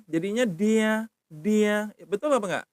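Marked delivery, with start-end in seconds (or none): 0.5-1.9 high, slow dia with voiced d; pronounced with D. Shown as a demonstration. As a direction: say dia with a clear d, drawn out. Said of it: "Kalau kamu pakai D".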